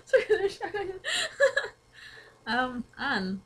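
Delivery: high, giggly and breaking up; talking through fits of laughter; giggling. Real, not acted. Friend giggling uncontrollably.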